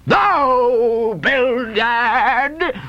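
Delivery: high, long-drawn bellow of a name; explosive old sea captain; furious roar. Acted. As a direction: roar at your partner in rage. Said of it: "roared Peleg".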